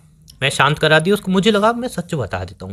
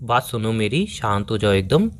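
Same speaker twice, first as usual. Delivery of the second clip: low, softened, soothing request; calming; 6 semitones lower, 5 dB quieter than usual. Acted. Boyfriend telling girlfriend to quiet down, low and calming. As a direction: lower your voice to settle her.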